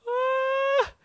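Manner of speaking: a sudden squeak of panic, very high; high panicked yelp. Retold or imitated. Panicking as cops arrive.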